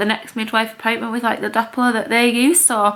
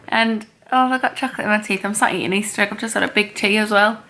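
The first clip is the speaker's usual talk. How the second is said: ordinary delivery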